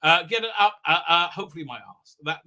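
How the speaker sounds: ordinary delivery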